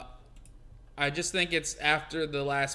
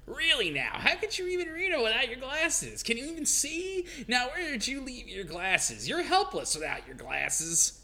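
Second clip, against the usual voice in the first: scolding maid voice; 7 semitones higher than usual. Acted. Voicing Mary scolding the troll.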